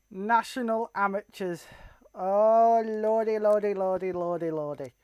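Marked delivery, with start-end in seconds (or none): 2.2-4.9 sinking, fading run of lordys; dismayed, deflating as it goes; dismayed lament. Real, not acted. Dismayed reaction to game.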